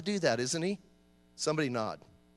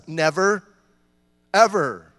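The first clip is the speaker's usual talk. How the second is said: rising, louder insistence on the second word; emphatic never ever; 2 semitones lower, 6 dB louder, slower than usual. Real, not acted. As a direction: pound it home, never, ever. Emphatic answer about the covenant.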